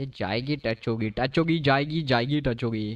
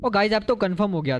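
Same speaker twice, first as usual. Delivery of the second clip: higher and louder "ओ guys"; excited exclaim; 8 semitones higher, 4 dB louder, faster than usual. Real, not acted. Streamer exclaiming to viewers, high and loud. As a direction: burst out to the chat.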